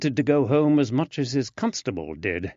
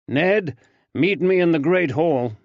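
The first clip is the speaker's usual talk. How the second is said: higher, louder call across the yard; 6 semitones higher, 6 dB louder than usual. Acted. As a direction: call after the constable. Lavender called him.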